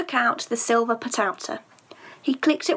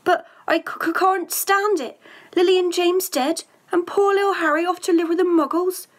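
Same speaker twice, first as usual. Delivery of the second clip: tearful; 8 semitones higher, 3 dB louder than usual. Acted. Hagrid weeping over Harry.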